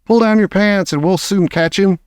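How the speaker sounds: mild high-pitched shout; shouted instruction. A narrator's reading. Another cook to the trapped man, shouted instruction. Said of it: "someone else shouted".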